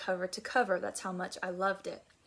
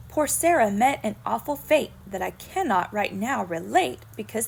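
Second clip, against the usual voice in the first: louder storyteller's climax; dramatic poem ending; 2 semitones higher, 9 dB louder than usual. Acted. Teacher warning the children with a poem's moral, louder and dramatic.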